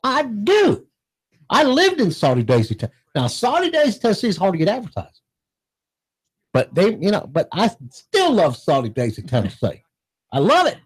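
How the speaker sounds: half-baked Texas twang; fake Southern accent. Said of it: "Is that Texas accent?".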